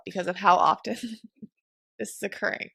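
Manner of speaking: reading through a real giggle; giggling. Real, not acted. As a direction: giggle breaking into the read-out. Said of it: "I'm giggling because Mom giggled".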